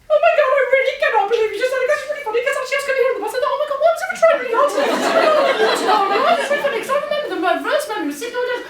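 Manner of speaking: put-on very high, loud, rapid breathless gushing teenage girl. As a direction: gush it all out in one excited rush. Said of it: "she went".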